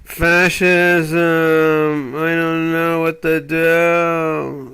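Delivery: lightly voiced mock alarm, drawn out at the end; mock panic. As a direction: mimic a frightened pundit. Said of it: "They get all scared".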